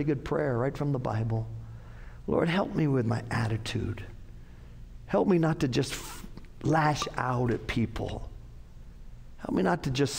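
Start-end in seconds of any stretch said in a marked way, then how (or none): none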